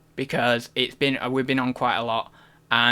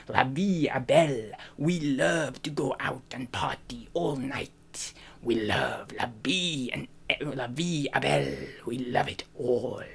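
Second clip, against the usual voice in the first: slow, soft French-Italian accent; drawn-out, hushed continental accent, half French, half Italian; French accent slipping into Italian; 6 dB quieter than usual. Shown as a demonstration. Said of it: "I think that's Italian".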